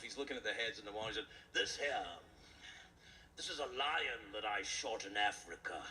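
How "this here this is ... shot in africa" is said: lightly voiced proud old hunter, a touch lower; boastful old hunter. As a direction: show off your trophies. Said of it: "the old hunter is showing off".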